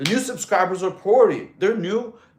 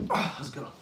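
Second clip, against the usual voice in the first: ordinary talk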